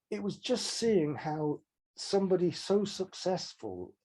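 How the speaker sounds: ordinary talk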